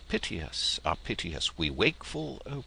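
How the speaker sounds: ordinary delivery, nothing marked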